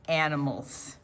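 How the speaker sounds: ordinary delivery, nothing marked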